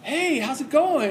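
lightly voiced easygoing Jesus; casual Jesus. Jesus greeting casually at night.

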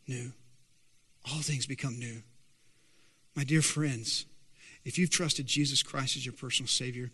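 soft, low, earnest pulpit voice; hushed preaching. Real, not acted. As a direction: bring it right down and speak tenderly.

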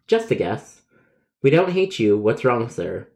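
ordinary delivery

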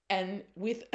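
words breaking into laughter; laughing. Real, not acted. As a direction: start the line already laughing. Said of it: "I'm laughing because".

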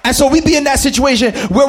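building gospel preaching. Acted. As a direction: preach it with rising fire. Preaching at full force.

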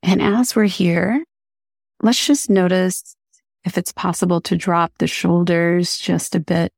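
calm, even meditation voice with long pauses; unhurried guiding, leaving space between phrases; guided meditation. Acted. Host guiding listeners through breathing, calm meditation voice.

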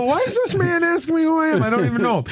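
high-pitched mock bewilderment; baffled retold reaction. Man recalling a stranger asking who he is, baffled.

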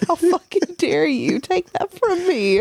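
put-on high, slow fussy old aunt; Aunt Bethany voice. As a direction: play the offended old aunt. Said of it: "in the Aunt Bethany voice".

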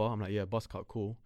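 ordinary delivery

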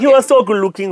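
put-on Nigerian accent. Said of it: "I can do better".

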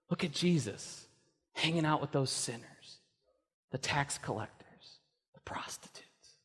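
softening to a whisper on the prostitutes; fading into whisper. Acted. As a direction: let it drop to a hush. Gossip about Jesus, dropping voice.

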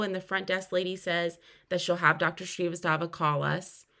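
normal talking voice

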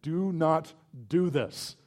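slow, each word pressed hard; stern emphasis. Shown as a demonstration. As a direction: lay stress on every word of "Do not do this".

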